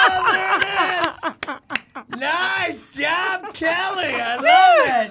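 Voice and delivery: loud, laughing cheers; excited cheering. Real, not acted. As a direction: whoop it up with joy.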